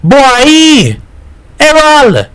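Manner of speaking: very high, drawn calling voice; shouting. A narrator's reading. Hat gets no answer from the boys, shouting. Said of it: "Hat shouting in the street".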